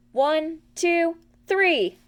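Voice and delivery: high, loud countdown; excited count. A narrator's reading. Piglet counting the toss, high excited cry. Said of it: "cried Piglet".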